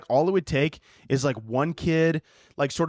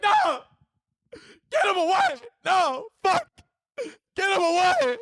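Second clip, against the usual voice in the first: very high, hammed-up sobbing scream; panicked crying shriek. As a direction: wail in terror to keep the child away. Panicked teacher sees the child.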